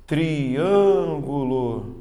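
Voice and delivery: "Triângulo" very slowly stretched as tri-ân-gu-lo; syllable separation. Shown as a demonstration. Teacher shows the class four syllables, "Triângulo" drawn out very slowly. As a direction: sound out "Triângulo" in four long syllables, very slow. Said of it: "tem que saber separar".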